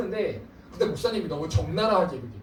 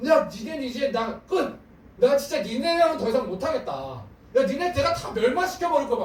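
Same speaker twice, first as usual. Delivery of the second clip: higher, louder threat; angry God; 6 semitones higher, 3 dB louder than usual. Retold or imitated. God warns his people he will sweep them away, loud angry threat. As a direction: thunder a warning at the people.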